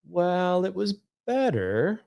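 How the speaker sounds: high, drawn-out, qualified approval; hesitant teacher. Retold or imitated. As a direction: imitate a teacher reluctantly conceding, stretching it out. Teacher's hesitant verdict, retold.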